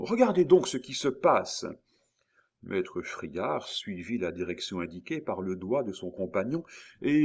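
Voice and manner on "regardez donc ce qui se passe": raised, higher urgent call; exclaiming. A narrator's reading. Miton urging his companion to look, exclaiming. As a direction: cry out to make them look. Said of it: "s'écria Miton".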